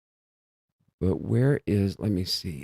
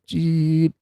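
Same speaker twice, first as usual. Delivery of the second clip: genie sound effect; 9 semitones higher than usual. Retold or imitated. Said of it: "a genie coming and go".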